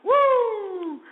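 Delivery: long, very high "woo"; triumphant whoop. Real, not acted. Host celebrating his guessing game, elated whoop.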